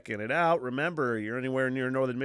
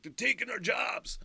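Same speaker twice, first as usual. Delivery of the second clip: mock protester; 9 semitones higher than usual. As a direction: ham up a panicked worker's complaint. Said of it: "people that are just like".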